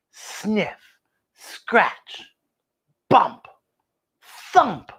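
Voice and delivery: usual speaking voice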